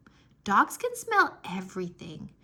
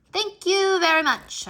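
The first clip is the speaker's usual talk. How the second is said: showy thank you; 9 semitones higher, 8 dB louder than usual. Pretend. Bows to imaginary class.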